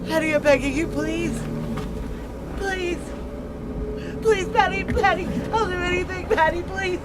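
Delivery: high loud broken pleading; anguished plea; tearful begging. Acted. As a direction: beg desperately through tears.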